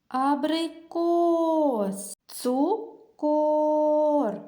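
slow, sound out each word for the K; drawn-out demo words. Shown as a demonstration. Teacher to children, slowly sounding out words. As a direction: stretch the words so children hear the K. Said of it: "Чули звук К всередині слова?".

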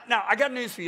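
ordinary delivery

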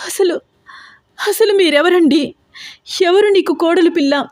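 high, halting frightened questions; scared girl stammering back at the villain; stammering fear. A narrator's reading. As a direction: stumble over the words in fear. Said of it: "Harika తడబడుతూ".

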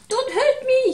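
a big lion squealing like a scared kitten; whimpering lion. Acted. Frightened lion begging the mouse, high whimpering plea. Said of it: "he whimpered".

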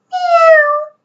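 very high mewing kitten; kitten meow. Acted. Kitten mewing from inside the blanket. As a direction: squeak it like a tiny kitten.

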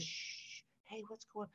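hushed, soft comforting whisper; calm two crying kids with a hush; mother hushing crying children softly; soothing hush. Retold or imitated. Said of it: "soothingly says".

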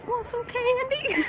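high, slow creepy candy offer; sweet creepy coaxing. Pretend. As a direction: lure a kid with sugary menace. Creepy stranger bit.

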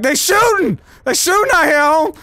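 high, loud mock-panicked yell; panicked quote. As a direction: shout it like a scared bystander. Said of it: "I'm like".

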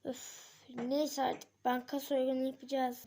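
normal talking voice

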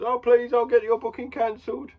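high, loud pleading voice; whiny pleading referee voice; begging ref. Retold or imitated. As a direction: beg desperately in a put-on voice. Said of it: "ref begs him".

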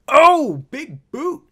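very loud, high burst; excited exclamation. Real, not acted. Gamer reacting to a sudden big boot, loud excited exclamation. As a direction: erupt at a big hit.

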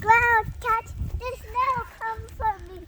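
excited call. Real, not acted. Child calling excitedly.

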